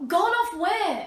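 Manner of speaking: high, slow loud yell; shouting question. Acted. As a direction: yell the question back slowly. Shouted reply in family quarrel.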